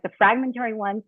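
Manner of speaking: ordinary talk, nothing marked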